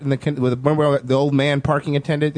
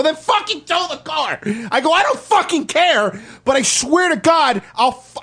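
much higher, slow furious threat; angry threat to the old parking attendant, much higher and slow; furious retold yell; 11 semitones higher than usual. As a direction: blow up at the parking guy. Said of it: "I got really pissed at him".

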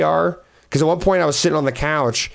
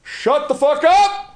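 buddy scolding the dogs; 12 semitones higher, slower than usual. Retold or imitated. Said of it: "was just like".

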